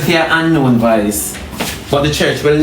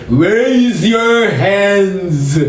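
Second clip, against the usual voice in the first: mock heavenly voice; 3 semitones higher, much slower than usual. Shown as a demonstration. Preacher mocks the voice people expect from God, grand and drawn out. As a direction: stretch every word like a grand voice from above. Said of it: "You never hear voice say".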